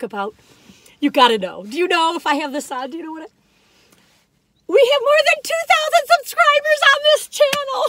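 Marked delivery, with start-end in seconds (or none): 4.7-7.8 high, loud burst of excitement; giddy subscriber news; thrilled. Real, not acted. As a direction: announce it bursting with joy. Thrilled about subscriber count.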